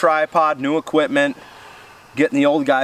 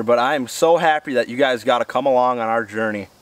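ordinary talk, nothing marked

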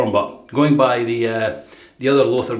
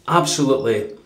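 normal talking voice

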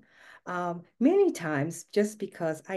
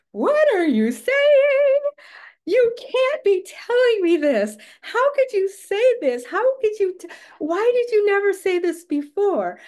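Laura Petrie wail; 14 semitones higher, 10 dB louder than usual. Retold or imitated. Wife stunned by husband's claim, high sitcom wail. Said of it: "I went into Laura Petrie mode".

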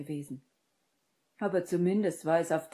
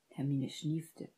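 plain reading voice

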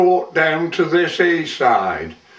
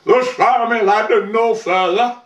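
higher-pitched scolding, as a storyteller voices it; mock-stern telling-off in a raised pitch; chiding; 7 semitones higher, faster than usual. A narrator's reading. King scolding each wave as it came, higher-pitched chiding. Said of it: "he was chiding each wave".

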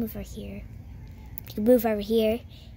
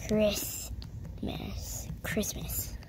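whispering; 9 dB quieter, much slower than usual. Real, not acted. Whispering her answer.

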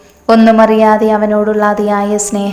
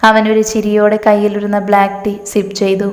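ordinary delivery, nothing marked

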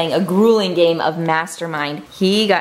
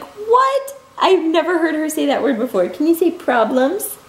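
high-pitched retold amazement; amazed, high-voiced "what?" moment; surprised reaction; 6 semitones higher than usual. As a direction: react in mock astonishment, pitch jumping up. Retells surprised reaction to daughter.

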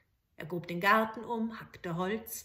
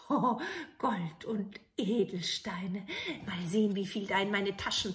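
awed whisper. Acted. Soldier finding the treasure, awed whisper. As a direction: breathe it in awe.

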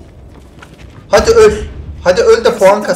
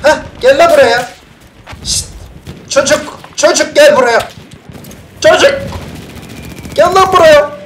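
calling out; 5 semitones higher, 4 dB louder than usual. Real, not acted. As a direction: yell for him to come. Çocuğu çağırıyor. (çocuğa yüksek sesle seslenme)

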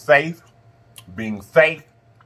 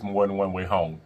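gentle low aside; 11 semitones lower, 7 dB quieter than usual. Real, not acted. Soft life advice.

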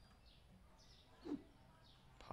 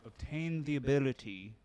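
loud, slow mock-grand announcer read; 9 dB louder, slower than usual. Streamer reading a shop upgrade to chat, lightly mock-grand. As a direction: stretch it out like a pompous ad.

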